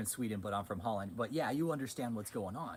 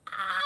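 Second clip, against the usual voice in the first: imitating a sudden high, loud "Ah!"; mock her intense outburst with a sharp high cry; hammed-up high shriek of intensity; 9 dB louder than usual. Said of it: "every time Catherine does her fucking E's".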